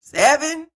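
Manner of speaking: high, loud, rising question; incredulous. Real, not acted. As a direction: blurt it out in disbelief. Shocked at the age mentioned.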